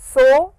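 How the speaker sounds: "so" held long; slow, drawn-out "so"; long vowel. Shown as a demonstration. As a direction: stretch out the o in "so". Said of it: "long sound".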